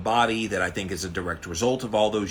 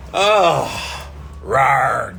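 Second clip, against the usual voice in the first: loud gasp into a growl; cold shock yell; 3 semitones higher, 8 dB louder than usual. Real, not acted. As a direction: yelp then roar at the cold. Getting into the ice water.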